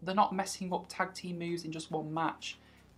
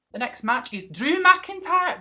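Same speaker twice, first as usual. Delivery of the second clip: higher, louder mock Scottish accent; a hammed-up bad Scottish accent; lightly put-on Scottish accent; 10 semitones higher, 9 dB louder than usual. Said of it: "That's a really bad accent".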